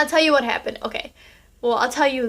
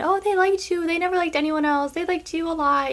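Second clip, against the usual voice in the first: higher-pitched retelling of a fib; mock reassurance; 5 semitones higher than usual. Mimics her own past lie.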